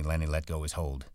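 plain reading voice

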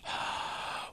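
quick inhale before counting; sharp gasp. A narrator's reading. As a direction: suck in a breath of air. Breath test before counting.

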